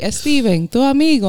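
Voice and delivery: imitated mother's Spanish accent. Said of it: "She's like".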